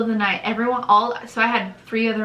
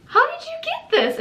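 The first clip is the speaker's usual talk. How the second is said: much higher, amazed question; astonished. Retold or imitated. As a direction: gasp it out in disbelief. She sees their photo on label.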